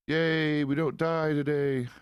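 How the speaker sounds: high, slow singsong yay; playful, drawn-out little hooray; drawn-out cheer. Pretend. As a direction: stretch out a high celebratory cheer. Cheering surviving in a game.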